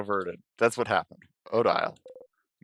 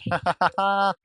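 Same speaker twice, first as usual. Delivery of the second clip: higher, louder short broken laugh; bitter laugh; 10 semitones higher, 6 dB louder than usual. Acted. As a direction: laugh at your own hopelessness. Laughs at failed pain trick.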